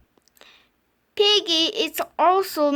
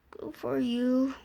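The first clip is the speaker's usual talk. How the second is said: dry sarcasm; 7 semitones lower, 9 dB quieter than usual. Acted. Dry reply.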